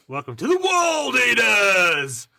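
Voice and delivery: high, drawn-out announcer yell; big hammed-up welcome bellow; rallying shout. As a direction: roar the army's name like a ring announcer. Bellowed climax of recruitment speech.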